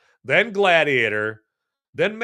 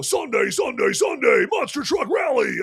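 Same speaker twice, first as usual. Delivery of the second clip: hammed-up shouted rally ad; monster truck announcer; 9 semitones higher than usual. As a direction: yell it like a monster truck commercial, much higher. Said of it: "I wanna hear some".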